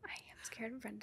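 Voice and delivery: very soft, quick whisper; barely audible whispered admission. Pretend. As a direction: whisper it like a secret confession. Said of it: "Why are you whispering?".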